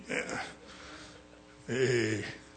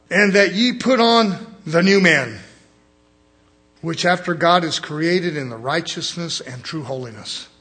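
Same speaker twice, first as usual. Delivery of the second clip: solemn pulpit reading of scripture; 4 dB louder than usual. Acted. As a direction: boom out the verse like a preacher. Reads scripture aloud.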